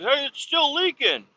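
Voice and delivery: high-pitched mimicked alert; imitated call-out. Other kid walking by reports a leak, mock high call. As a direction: imitate someone calling out in passing, pitched high. Passing kid calling out, retold.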